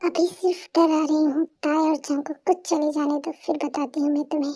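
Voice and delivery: soft low menacing purr; menacing hush. Acted. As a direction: threaten him quietly, teasing. Continued quiet threat.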